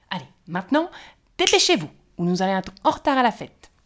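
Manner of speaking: hop about and hurry them along; impatient urging. A narrator's reading. The impatient gnome urges the fairies to the feast, loud and fast. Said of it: "trépignait d'impatience".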